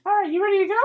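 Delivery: very high falsetto character voice; tiny high-pitched adventurer; Ford. Acted. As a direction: squeak it out in a small, high voice. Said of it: "you have Ford goes".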